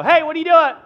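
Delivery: high, loud "hey" at a stranger; startled yell. Acted. Car owner yelling at a stranger grabbing the door, high and loud. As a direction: catch someone grabbing your car door.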